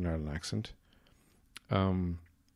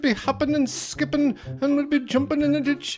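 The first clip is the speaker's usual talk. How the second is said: very high, loud stage-Irish rant; hammed-up Irish outburst, building to an indignant yell; ranting Irish character; 7 dB louder than usual. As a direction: rave in an over-the-top brogue. Spoof trailer builds into Irish rant.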